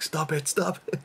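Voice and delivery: high-pitched mock protest; retold alarmed objection; imitating fiance. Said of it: "She was like".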